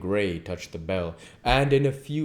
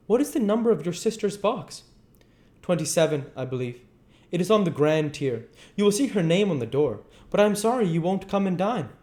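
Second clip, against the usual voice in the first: raised, lively character voices; character dialogue; 11 semitones higher, 3 dB louder, faster than usual. A narrator's reading. Reader voicing Henry and Dorian in high dialogue.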